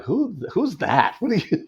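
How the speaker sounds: high, drawn-out mock disbelief; baffled friends. Friends watching the show react to his character, mock bafflement. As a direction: imitate friends' incredulous squeal of confusion.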